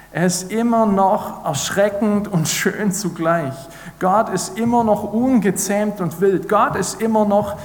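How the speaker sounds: höher und lauter, IMMER jedes Mal betont; eindringliche Predigt. Acted. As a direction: predige mit voller Kraft, Satz für Satz steigernd. Steigernder Predigtteil. (Prediger zur Gemeinde, eindringlich und laut, IMMER betont)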